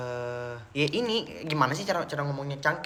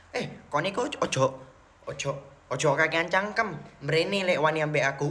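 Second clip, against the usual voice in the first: mock Surabaya Javanese; 2 semitones higher than usual. Mock Surabaya Javanese quarrel line.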